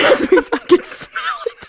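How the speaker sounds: high and laughing; amused high complaint. Real, not acted. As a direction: say it giggling.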